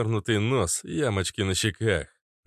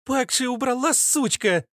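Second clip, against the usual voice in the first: put-on very high, angry girlish retort; snapping feisty girl; 3 dB louder than usual. Captured girl snaps at the woman touching her, high-pitched. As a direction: snap back in a high squeak. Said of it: "огрызнулась девушка".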